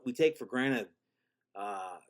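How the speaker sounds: usual speaking voice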